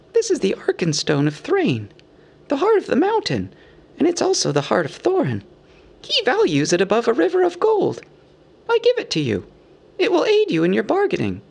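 high hobbit voice making a presentation; earnest hobbit. A narrator's reading. Bilbo gives away the Arkenstone, earnest. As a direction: offer the treasure solemnly in a high voice.

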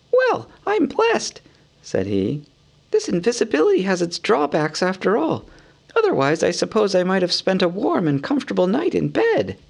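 high, light hobbit voice; a small, fretful hobbit muttering; Bilbo voice. A narrator's reading.

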